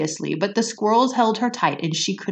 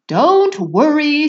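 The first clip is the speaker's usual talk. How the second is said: Wonka voice; 10 semitones higher, 9 dB louder, slower than usual. Acted. Wonka mock reassurance.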